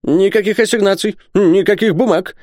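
high-pitched merchant's protest. A narrator's reading. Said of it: "запротестовал Исаак Хакабут".

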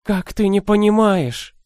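put-on high, slow, anguished old woman; grieving old woman. A narrator's reading. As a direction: lament it like a desperate old nanny. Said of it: "сокрушалась добрая старуха".

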